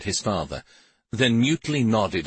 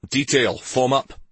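higher-pitched military order; snapped command; 5 semitones higher, 3 dB louder than usual. Acted. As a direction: snap it out to the troops. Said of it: "Karvik snapped".

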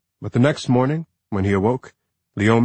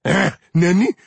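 sharp raised call of her name; shouting; 10 semitones higher, 6 dB louder than usual. A narrator's reading. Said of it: "he shouted".

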